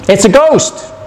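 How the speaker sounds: mild scared cry of the disciples; frightened cry. A narrator's reading. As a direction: blurt it out in fright. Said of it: "and cried out in fear".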